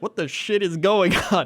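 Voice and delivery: laughing what the shit; baffled outburst. Retold or imitated. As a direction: blurt it out in disbelief. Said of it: "you're just like".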